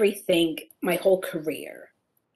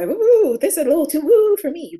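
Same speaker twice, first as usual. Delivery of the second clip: higher, louder mock skeptical ooh; skeptical people; 9 semitones higher, 10 dB louder than usual. Imitating doubters of mirror work, mock skeptical and loud. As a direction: pull back like it's too much. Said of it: "sometimes people are".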